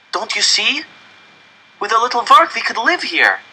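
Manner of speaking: put-on high, eager child's voice selling the idea; eager pleading. Janek urging his parents to move into the coop, eager. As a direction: convince them, bursting with hope.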